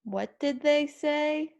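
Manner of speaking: high, rising question; rising pitch. Shown as a demonstration. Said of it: "you had rising pitch".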